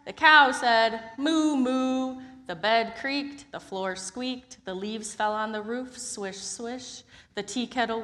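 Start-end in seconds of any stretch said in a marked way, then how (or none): 1.2-2.2 cow mooing. Acted. Cow noise.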